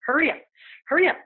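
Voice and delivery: impatient mother rushing her daughter; pushy ghost voice. Retold or imitated. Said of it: "I hear a woman's voice going".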